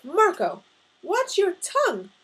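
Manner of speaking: loud, high-pitched scolding; sharp interjection. A narrator's reading. Mother cuts into father-son quarrel, loud scolding. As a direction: cut in and scold him loudly. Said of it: "my mother interjected".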